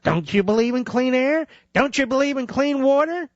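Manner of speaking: high-pitched, pleading parody of activists; mock indignation. Host imitating regulation pushers challenging doubters, mock indignation. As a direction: make each question a mock-outraged appeal.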